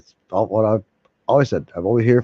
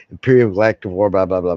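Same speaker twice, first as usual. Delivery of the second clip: ordinary talk, nothing marked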